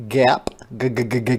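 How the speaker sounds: usual speaking voice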